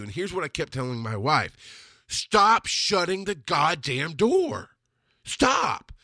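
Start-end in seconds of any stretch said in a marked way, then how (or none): 2.1-5.9 slow, high-pitched emphatic protest; exasperated plea. Retold or imitated. Husband urging wife to stop refusing value, exasperated. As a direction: drag out each word, pitched far up. Retold exhortation to his wife.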